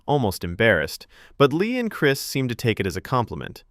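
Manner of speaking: usual speaking voice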